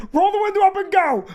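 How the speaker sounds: high, urgent wife's plea; nervous wife telling him to drive off; frightened wife. Retold or imitated. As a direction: hurry him along, scared. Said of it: "his wife goes".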